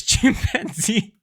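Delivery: laughing. Real, not acted. Reviewer cracks up listing Luffy's names. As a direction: giggle out chimpanzee.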